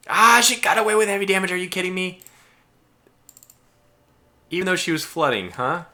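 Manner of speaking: loud, high-pitched cry of disbelief; outraged shout. Real, not acted. Player as the damaged ship gets away, outraged shout. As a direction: yell in real exasperation.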